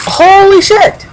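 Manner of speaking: high, loud shocked cry of "Holy shit!"; real astonishment at the screen; shocked exclamation. Real, not acted. Sudden shock in game.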